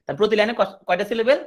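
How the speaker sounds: ordinary talk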